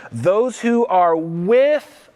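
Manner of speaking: drawn out, rising to invite the answer; with stretched and left hanging for the crowd; slow cue for a response. Shown as a demonstration. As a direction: pause on with so they shout it back. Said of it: "what? What's the next word?".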